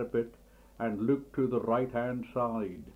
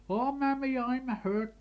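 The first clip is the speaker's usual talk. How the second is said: put-on much higher childish wail; 10 semitones higher than usual. A narrator's reading. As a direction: whimper it like a spoilt boy. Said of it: "wails the lad".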